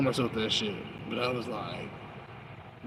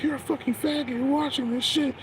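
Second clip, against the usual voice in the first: high, mocking voice of a hater; mock the hostile commenter up high; mocking the guy; 14 semitones higher than usual. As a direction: sneer it up high like the guy in the video. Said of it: "the guy was like".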